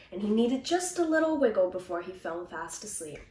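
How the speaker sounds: ordinary delivery, nothing marked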